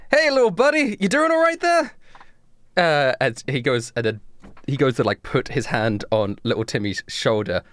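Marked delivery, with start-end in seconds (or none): 0.1-1.9 very high-pitched, chummy check-in; friendly Blake. Acted. Blake checks on little Timmy, chummy. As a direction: call over cheerily to a small pal. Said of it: "and Blake goes".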